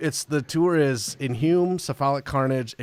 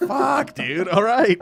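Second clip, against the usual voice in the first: resigned exasperation; 8 semitones higher, 4 dB louder, slower than usual. Retold or imitated. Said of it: "you're like".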